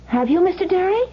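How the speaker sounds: high, strained, daring him to deny it; nervous challenge. Acted. Wife challenges the hitman in her living room, nervous. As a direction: brave front over fear.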